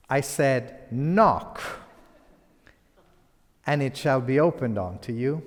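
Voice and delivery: loud, emphatic offended preacher; putting her in her place. Acted. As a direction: hammer it out as an indignant preacher. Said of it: "he wants to put her in her place".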